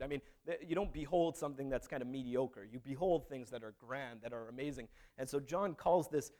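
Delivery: usual speaking voice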